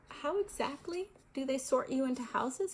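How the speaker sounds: put-on young boy's nervous question; boy asking nervously. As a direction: ask it like an anxious young boy. Harry nervously asks Ron.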